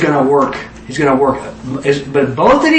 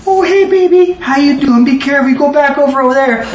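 put-on much higher, sugary baby talk to his daughter; coach baby talk; 10 semitones higher than usual. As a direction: melt into cooing for his little girl. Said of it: "you talk like a baby".

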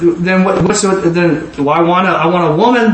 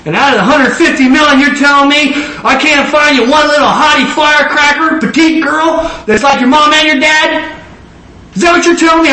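incredulous rebuke; 9 semitones higher than usual. Retold or imitated. God pressing the preacher about finding a wife, incredulous and rising. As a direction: press him, voice climbing in amazement.

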